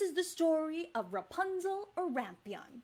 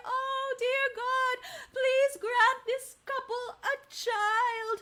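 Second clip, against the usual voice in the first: higher, slow pleading prayer; prayer to say along; 6 semitones higher, slower than usual. Shown as a demonstration. Teacher leading the kids in prayer, slow and pleading. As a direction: pray it slowly and earnestly. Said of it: "Can you all say it".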